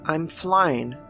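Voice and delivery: usual speaking voice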